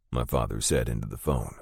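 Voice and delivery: ordinary delivery, nothing marked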